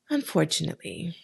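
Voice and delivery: ordinary delivery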